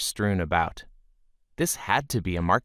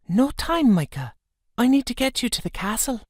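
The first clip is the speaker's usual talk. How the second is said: much higher, quick urgent voice; rushed higher female voice; urgent young woman; 14 semitones higher than usual. A narrator's reading. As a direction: hurry him along with urgency. Muirin voiced, urgent rescue.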